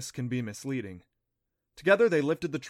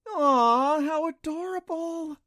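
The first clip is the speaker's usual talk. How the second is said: much higher, slow cooing "Aw, how adorable"; delighted coo; 7 dB louder, slower than usual. A narrator's reading. Clancy to the little creature, a high delighted coo. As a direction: coo over something cute. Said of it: "Clancy whispered with delight".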